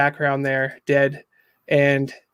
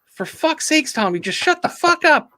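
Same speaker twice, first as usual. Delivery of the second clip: exasperated Jessica; 8 semitones higher than usual. Retold or imitated. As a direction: voice her snapping at Tommy, high and exasperated. Jessica snapping at Tommy.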